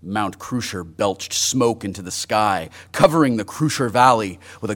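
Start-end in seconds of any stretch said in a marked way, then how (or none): none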